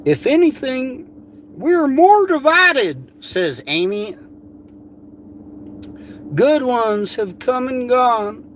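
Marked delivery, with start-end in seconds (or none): 0.0-3.1 mocking Amy voice. Mocking her post in a high put-on voice. 6.3-8.4 mocking Amy voice.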